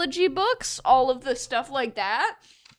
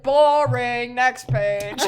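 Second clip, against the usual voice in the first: bored dismissal; 7 dB louder, much slower than usual. Retold or imitated. Child dismissing the page.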